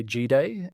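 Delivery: "G Day" said as letter G plus day; mispronounced G'day. Retold or imitated. Branding guy imitates foreigners misreading his name, mispronounced "G Day". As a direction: say "G Day" as two words, gee day. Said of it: "they usually say".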